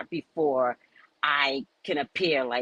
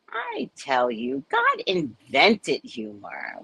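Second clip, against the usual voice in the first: slow God pronouncement; 5 semitones higher, slower than usual. Retold or imitated. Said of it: "God goes".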